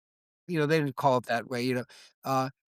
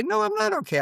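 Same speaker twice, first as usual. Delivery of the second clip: emphatic no. Retold or imitated. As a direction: burst out that you're not okay. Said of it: "somebody says, "You okay?".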